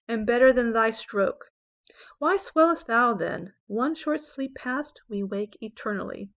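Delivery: slow, measured poetry reading with weighted pauses; poem recitation. Acted. Teacher reciting a sonnet to students, slow dramatic recitation. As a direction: recite it deliberately, stretching the key words.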